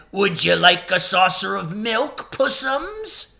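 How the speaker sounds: put-on high sneering baby talk; mocking coo. A narrator's reading. Crowd taunting Aslan with mock sweetness. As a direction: fake sweetness, pure mockery. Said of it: "jeering at him".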